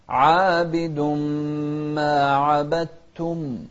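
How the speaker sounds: slow melodic tajweed chant; Quran recitation. Shown as a demonstration. Teacher reciting Quran to students, slow melodic chant.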